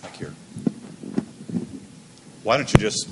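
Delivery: ordinary delivery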